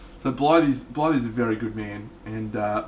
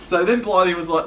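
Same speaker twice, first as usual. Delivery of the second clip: usual speaking voice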